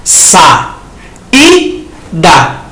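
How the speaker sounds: slow syllable-by-syllable "Sa, i, da"; syllables split apart. Shown as a demonstration. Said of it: "Assim como na palavra saída".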